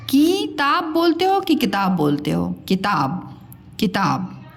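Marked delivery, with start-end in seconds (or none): 0.1-0.8 किताब said kee-taab, long high kee; गलत लंबी ई. Shown as a demonstration. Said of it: "किताब नहीं". 2.7-4.4 किताब with quick ki, low pitch; सही छोटी इ. Shown as a demonstration. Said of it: "हम बोलते हैं किताब".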